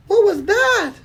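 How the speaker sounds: put-on high curious child voice; little girl voice.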